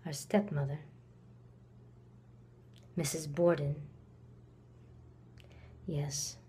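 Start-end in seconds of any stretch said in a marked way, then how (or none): 2.9-3.8 low and soft; subdued, low and cool; cold, quiet naming. Acted. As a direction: say the name under your breath. Naming the stepmother coolly.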